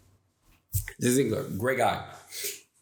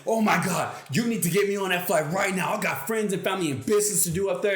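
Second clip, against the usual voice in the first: higher, fast mock panic; going bananas; 7 semitones higher, faster than usual. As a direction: rattle it off like a hammed-up frantic passenger. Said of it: "Would you prefer that I go bananas".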